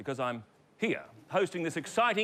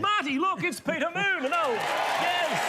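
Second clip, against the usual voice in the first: high excited host call; higher and louder host announcing a surprise; excited reveal; 12 semitones higher, 4 dB louder than usual. Acted. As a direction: jump up in pitch, thrilled. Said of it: "excited host reveal".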